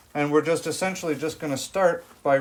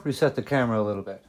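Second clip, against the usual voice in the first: normal talking voice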